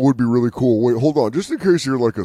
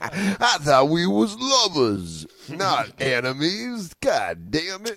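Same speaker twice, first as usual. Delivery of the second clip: slow, high hammed-up lament; mock heartbroken; 4 semitones higher, much slower than usual. Caught man to his captors, mock heartbroken. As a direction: stretch out each wounded phrase, high.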